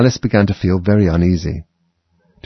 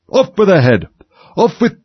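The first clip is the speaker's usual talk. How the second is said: high, loud shriek of a furious queen; screaming; 11 semitones higher, 5 dB louder than usual. A narrator's reading. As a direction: shriek the order at full pitch. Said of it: "began screaming".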